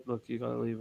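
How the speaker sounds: hushed order to leave. Retold or imitated. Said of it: "they say to the guy".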